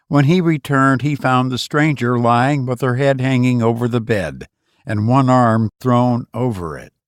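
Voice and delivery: plain reading voice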